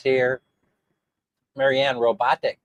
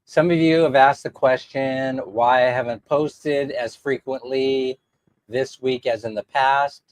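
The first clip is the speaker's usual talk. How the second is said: usual speaking voice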